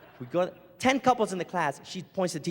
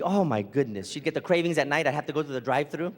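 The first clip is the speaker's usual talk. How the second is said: usual speaking voice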